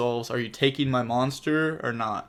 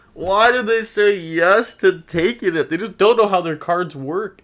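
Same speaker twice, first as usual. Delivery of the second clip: exasperated rant; 7 semitones higher, 6 dB louder than usual. Real, not acted. Ranting at opponent's play.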